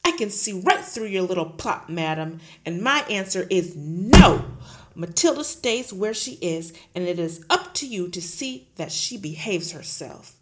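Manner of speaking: loud, booming headmistress; bully the teacher at full volume; Trunchbull bullying. Acted. Headmistress roaring at Miss Honey, loud and bullying.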